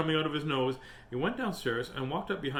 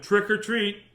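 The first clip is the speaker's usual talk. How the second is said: louder, high-pitched call; a little louder; 7 semitones higher, 7 dB louder, slower than usual. A narrator's reading. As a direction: raise the voice to get an answer. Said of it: "Then he said a little louder".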